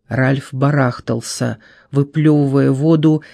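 ordinary delivery

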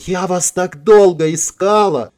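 high, drawn-out gushing cry; acted swooning declaration; mock adoration. A narrator's reading. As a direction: gush it like a long-lost lover. Throws herself on his neck.

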